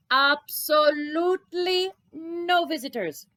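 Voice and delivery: slow, loud, every word printed; bold declaration. Acted. Rabbi reading his new sign, slow and emphatic.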